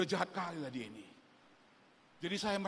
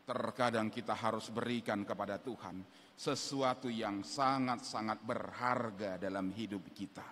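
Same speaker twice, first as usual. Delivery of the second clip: usual speaking voice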